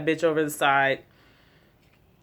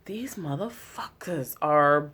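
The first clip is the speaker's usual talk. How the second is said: slow whisper; whispered Negan; slower than usual. Retold or imitated. Vlogger voicing Negan muttering to himself, whispered. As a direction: breathe it like Negan plotting. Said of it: "Negan was like".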